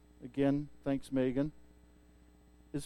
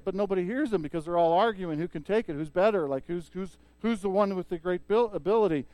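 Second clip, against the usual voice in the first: ordinary talk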